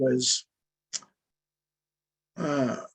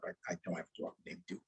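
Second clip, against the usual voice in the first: whispered confession, much softer, almost under the breath; whispered aside; 7 semitones lower, 19 dB quieter than usual. Real, not acted. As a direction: let the admission slip out in a whisper. Admits the made-up detail.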